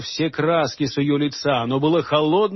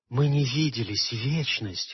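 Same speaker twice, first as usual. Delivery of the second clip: soft low whisper; 4 semitones lower, 6 dB quieter than usual. A narrator's reading. Morgana whispering to Holger after long separation. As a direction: breathe it close and soft. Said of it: "шептала она".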